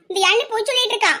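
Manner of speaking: ordinary talk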